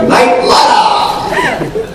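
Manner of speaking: very high, slow shouted "Knight Rider" said like "Night Lider"; squealing Japanese dub cry, laughing. Retold or imitated. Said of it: "But they actually say".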